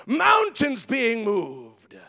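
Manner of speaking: loud, drawn-out proclamation; slow shout. Acted. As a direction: hammer each word slowly. Preaching climax, slow shout.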